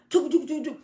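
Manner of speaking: loud, high spoken knocks; vocal door knocking; knocking sound. Acted. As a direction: rap it out like knuckles on wood.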